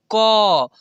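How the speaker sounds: क said full and loud with its vowel, kə; full ka sound. Shown as a demonstration. Teacher showing students the full क sound. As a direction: say क clearly as a full syllable, kə. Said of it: "इसको क को कैसे बोल रहे हैं".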